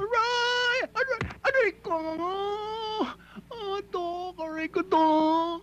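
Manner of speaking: sharp cries of pain; yelping in real pain; pain hiss. Real, not acted. Wound being cleaned, crying out.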